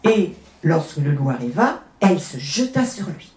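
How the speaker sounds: plain reading voice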